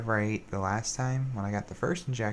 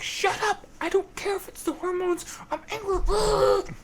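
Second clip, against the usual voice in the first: angry outburst; 5 dB louder than usual. Retold or imitated. Her emotions yelling down the logical voice, a high furious scream. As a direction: scream it like boiling-over emotions. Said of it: "the emotions are like".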